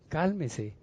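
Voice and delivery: soft, hushed plea for calm; gentle calming word; soothing. Acted. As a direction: quietly reassure someone in a storm. Calming someone in the storm.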